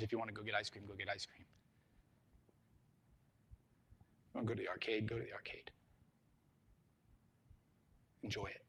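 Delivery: gentle dad. Acted. Dad handing his son spare money, gently quiet. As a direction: let it sink softly, unhurried.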